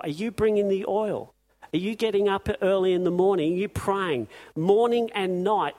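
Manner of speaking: rally the congregation with urgent questions; fiery preaching. Acted. Preaching rising to full force.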